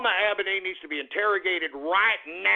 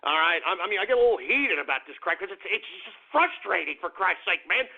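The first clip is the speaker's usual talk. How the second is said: normal talking voice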